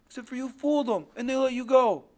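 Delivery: put-on high, loud child's protest; bitter accusation. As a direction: build to an indignant cry.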